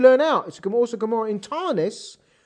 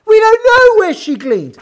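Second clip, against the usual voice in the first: much louder and higher, "before even finding out" driven hard; emphatic exclamation; 11 semitones higher, 12 dB louder than usual. Real, not acted. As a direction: exclaim it as an outraged teacher. Teacher exclaims at the puzzle.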